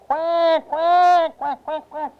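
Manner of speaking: high duck quacks, long then short; scared ducklings quacking. Acted. Storyteller plays ducks looking down at the bear, scared quacking. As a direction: quack in alarm like little ducks. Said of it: "they were so scared".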